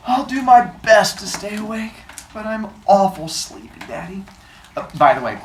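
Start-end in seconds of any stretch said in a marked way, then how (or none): 0.1-4.2 put-on sleepy kid voice. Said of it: "young kid voice".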